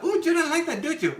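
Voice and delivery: mocking tease. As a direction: rub it in playfully. Mocking the picky friend.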